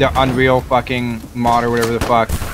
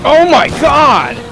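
shocked exclamation; 13 semitones higher, 7 dB louder, much slower than usual. Real, not acted. Squad just got wiped.

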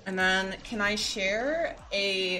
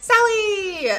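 gasp, then a high, loud cry of the name; delighted greeting; 11 semitones higher, 8 dB louder than usual. Real, not acted. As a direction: call out to a friend you just spotted. Spotting a friend in chat.

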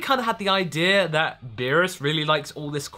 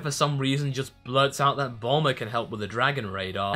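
ordinary talk, nothing marked

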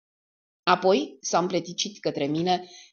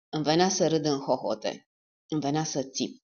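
ordinary delivery, nothing marked

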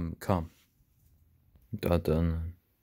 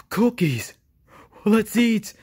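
gasping, high and thrilled; excited; 9 semitones higher, much slower than usual. Acted. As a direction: squeal over finding cookies. Finding cookies, thrilled.

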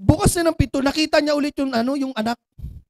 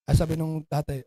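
ordinary talk

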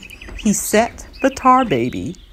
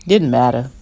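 ordinary delivery

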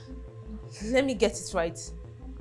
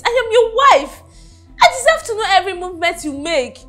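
insistent wife; 7 semitones higher, 5 dB louder than usual. Acted. Wife insists on her rights.

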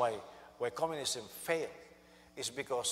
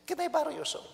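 much higher, sheepish little request; mock-innocent high voice; comic high ask; 4 dB louder, faster than usual. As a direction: play David as a sheepish boy asking a favour. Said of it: "He said".

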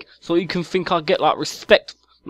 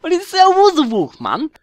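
indignant shout; 10 semitones higher than usual. Acted. Chav character shouts defensively.

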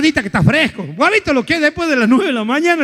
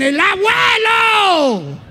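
high, long drawn call; family calling grandpa in a panic; 7 semitones higher, much slower than usual. Acted. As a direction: shout across the street for grandpa.

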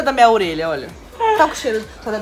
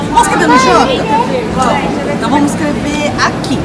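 excited; 5 semitones higher, 5 dB louder than usual. Real, not acted. Excited suggestion while writing post-its.

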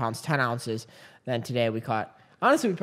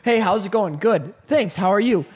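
mock pickup exchange; 5 semitones higher, 6 dB louder than usual. Acting out a dating app exchange.

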